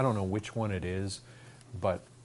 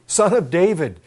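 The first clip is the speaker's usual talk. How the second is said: loud, high cry to be heard; raised-voice plea from the preacher retelling; 6 semitones higher, 9 dB louder than usual. Retold or imitated. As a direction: call out urgently over a crowd. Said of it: "He cried out".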